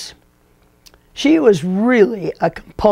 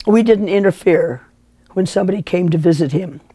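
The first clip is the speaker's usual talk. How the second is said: ordinary talk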